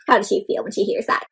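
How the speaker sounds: usual speaking voice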